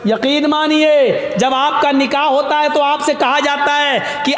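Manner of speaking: high, slow ceremonial nikah wording; solemn recitation. Acted. Preacher solemnly reciting nikah formula. (نکاح کے کلمات کی سنجیدہ ادائیگی)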